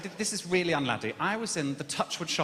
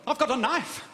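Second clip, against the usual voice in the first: loud, high mock-confident threat; sudden bravado; 8 semitones higher, 5 dB louder than usual. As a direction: blurt it out with put-on bravado. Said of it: "where I got the confidence from".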